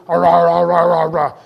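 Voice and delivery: loud, low "rah-rah" munching; greedy gobbling noise. Acted. Speaker imitating humans eating, loud greedy gobbling. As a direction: gobble noisily on one low note. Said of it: "Humans are walking around, we're eating food".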